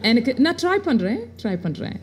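hesitant mock presenter in a put-on accent; horrible anglicised Tamil accent. TV presenter struggling with Tamil, in a horrible put-on accent. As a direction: stumble through Tamil with an affected foreign accent. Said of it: "in a really horrible accent".

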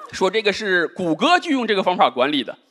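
ordinary talk, nothing marked

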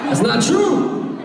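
high slow shout to crowd; calling out. Real, not acted. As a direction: call it out to them.

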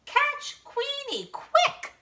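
very high duck alarm call; Dottie. A narrator's reading. Duck rousing others to catch the dog, high squawking. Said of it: "quacks Dottie".

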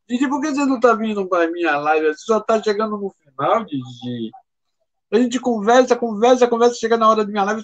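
hammed-up whiny reproach; mock complaint. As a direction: tease a friend for showing up late. Teasing a latecomer viewer.

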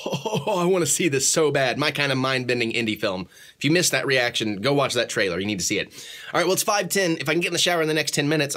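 ordinary delivery

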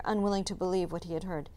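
ordinary delivery

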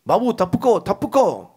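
mother's mock yell warning her son; warning shout. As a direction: call the boy out of harm's way. Mother warns child of danger.

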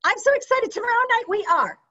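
high, fast, bubbling excitement; excited and fast. Shown as a demonstration. Coach demoing the excited phone script to her team. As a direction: gush it out quickly, thrilled. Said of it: "Did you notice how fast I'm talking?".

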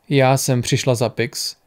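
ordinary delivery

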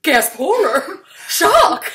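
mock shock. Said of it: "Everyone's always like".

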